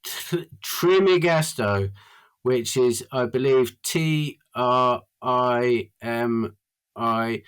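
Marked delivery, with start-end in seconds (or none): 0.0-1.9 slow, higher-pitched enunciation; slow, careful name. Shown as a demonstration. Repeating a name so people can Google it, said slowly. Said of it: "say his name again slowly". 3.8-7.4 letter by letter, very slowly; slow spelling. Shown as a demonstration. Spelling a name for listeners to look up, said very slowly. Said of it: "slowly so people can Google it".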